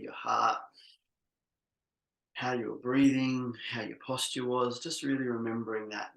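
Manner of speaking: normal talking voice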